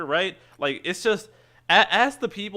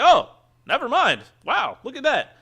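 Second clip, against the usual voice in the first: surprised; 11 semitones higher, 6 dB louder than usual. Retold or imitated. Retelling his own surprised reaction.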